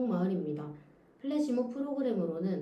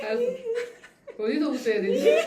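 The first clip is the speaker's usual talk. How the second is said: giggly, louder question; asking while still giggling; stifled giggles; 14 semitones higher, 7 dB louder, faster than usual. Real, not acted. Still laughing after the failed take.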